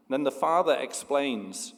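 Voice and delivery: usual speaking voice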